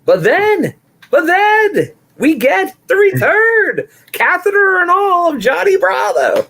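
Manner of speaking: high, drawn-out dramatic build-up; hyped reveal. Retold or imitated. Podcaster revealing the return of Johnny Bravo, excited high announcement. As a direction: announce a shock comeback with mock grandeur.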